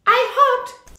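put-on high little girl; little girl Ruby. A narrator's reading. Ruby answers the teacher, high little-girl voice. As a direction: say it simply like a small girl. Ruby's voice.

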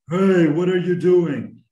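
loud, slow, high-pitched yell; adult scream demo. Shown as a demonstration. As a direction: bellow it like a grown-up yelling at kids. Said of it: "if I were screaming".

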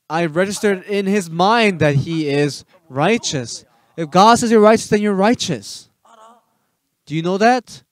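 loud, high preaching; full-force preaching. Acted. Preacher insists to the listeners that God's word stands, booming. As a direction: proclaim it to the whole room.

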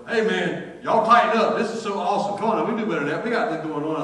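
loud, high, pumped-up cheerleading; rally the crew with big energy; excited rallying. Retold or imitated. Changed worker rallying others while stocking shelves, excited.

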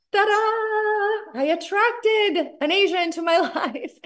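high, gleeful sing-out breaking into a laugh; triumphant ta-da. Retold or imitated. Seller celebrating that her wish came true, high and triumphant. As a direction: announce it like a magic reveal. Gleeful announcement, retold reaction.

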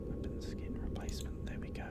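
whispered under breath; whispering. Real, not acted. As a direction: mutter it under your breath. Muttering to himself.

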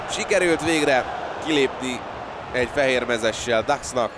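loud, high, rushed play call; excited sports commentary. Real, not acted. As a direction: call the breakaway with rising excitement. Commentator reacts to a breakaway.

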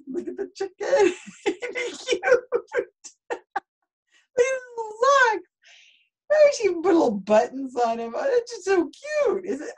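very high, squealy gushing through laughter; laughing cooing. Real, not acted. Reader showing the dressed chicken's little socks, laughing cooing. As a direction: coo over something adorable, laughing.

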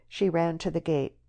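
plain reading voice

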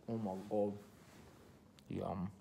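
muffled savoring speech; talking while chewing. Real, not acted. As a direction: mouth full of cookie. Seen 4: Bites cookie at -2s, closed mouth chews while speaking, cookie in hand.

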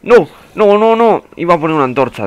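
high cries easing into loud protest; high, loud outburst of protest; loud dismay. Real, not acted. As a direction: wail out in frustrated alarm. Dismayed as game goes wrong.

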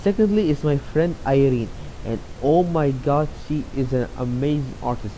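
ordinary talk, nothing marked

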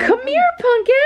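put-on baby talk. Calling baby.

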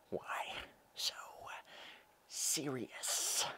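whispered, drawn-out menace; villain impression. Villain asking why so serious, whispered slow impression. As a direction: whisper it with long pauses between words. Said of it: "I have seventeen impressions for you".

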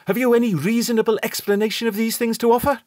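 brisk, pointed doctor's challenge; sharp challenge. A narrator's reading. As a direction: turn on him sharply. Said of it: "The doctor turned sharply on me".

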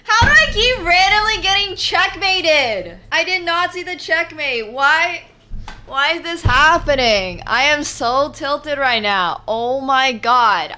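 tilted rant. Real, not acted. Streamer ranting after another checkmate, loud and frustrated. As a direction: rant like you're totally tilted.

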